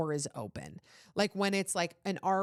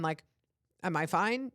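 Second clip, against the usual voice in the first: ordinary talk, nothing marked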